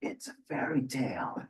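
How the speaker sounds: breathy whisper, voice dropped; whispered aside. Real, not acted. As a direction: let them in on a secret, softly.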